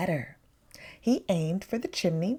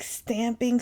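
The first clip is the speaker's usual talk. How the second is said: ordinary delivery, nothing marked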